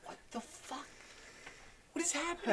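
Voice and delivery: high, breathy stunned whisper; baffled disbelief. Real, not acted. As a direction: react like nothing makes sense. Reacting to absurd penguin claim.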